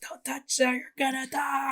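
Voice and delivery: very high-pitched mock scream; piercing cartoon shriek of alarm; Toad. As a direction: squeal a frantic, hammed-up warning. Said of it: "then Toad pops up".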